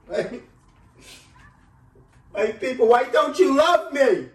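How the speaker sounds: hammed-up anguished plea; wailing plea. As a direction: beg the crowd in an overblown cry. Escalating comic plea to audience.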